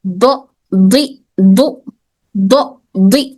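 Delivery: دو and دی spoken slowly, one by one; slow letter drill. Shown as a demonstration. Teacher drilling vowel forms of the letter, slow and deliberate. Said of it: "کیسی آواز باہر نکل رہی".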